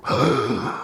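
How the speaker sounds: sharp mock gasp of betrayal; shocked gasp. Diner overhearing Barbara greet another table, shocked gasp. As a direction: gasp theatrically, affronted.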